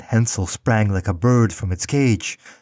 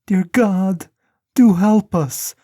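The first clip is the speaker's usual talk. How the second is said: put-on much higher, pleading little girl voice; crying girl; 11 semitones higher, 4 dB louder, slower than usual. A narrator's reading. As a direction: beg God for help in tears. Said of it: "she cried".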